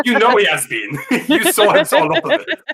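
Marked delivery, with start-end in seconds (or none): 1.1-2.6 higher, louder, laughing through it; giggling while teasing the players; laughing at his own joke. Real, not acted. Laughing at the bragging champ.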